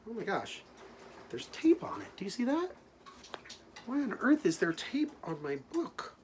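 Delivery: high, puzzled surprise; mock surprise. Teacher discovering tape on the book, high surprise. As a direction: gasp it out in astonishment.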